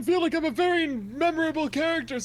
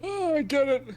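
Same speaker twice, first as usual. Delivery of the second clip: usual speaking voice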